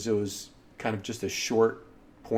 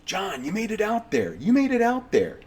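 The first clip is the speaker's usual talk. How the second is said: high, excited exclamation; excited friend, voice leaping much higher; 10 semitones higher than usual. Retold or imitated. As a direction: call out in thrilled amazement, pitch way up. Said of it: "he's all excited".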